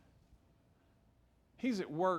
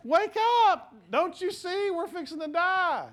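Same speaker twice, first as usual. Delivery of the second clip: much higher and louder, a hammed-up panicked yell at the sleeping Jesus; frustrated disciple; 7 dB louder than usual. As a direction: shake him awake in mock outrage. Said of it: "He's like".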